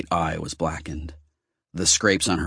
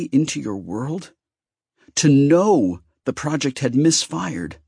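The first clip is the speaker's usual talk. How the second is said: higher and higher, aching question; rising hurt reproach; 8 semitones higher, 3 dB louder than usual. A narrator's reading. Wounded goddess reproaches Ben for not coming. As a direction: pour out the hurt.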